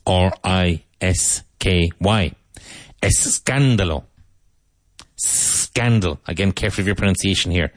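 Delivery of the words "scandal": "scandal" with a long hissed S at the start; sss-candal, drawing out the first sound of "scandal"; elongated S. Shown as a demonstration. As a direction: hold the opening S of "scandal". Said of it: "Elongate the S".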